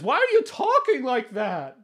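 high, thin-volume call from down the pipe; high-pitched questioning character voice; Felix. Acted. Felix questions the catfish from inside the pipe, high-voiced. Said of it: "And he says".

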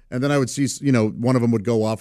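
ordinary talk, nothing marked

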